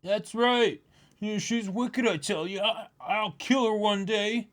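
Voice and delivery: put-on high, mischievous kid voice; a bratty child telling tales; childish glee about revenge. Voicing child in manga.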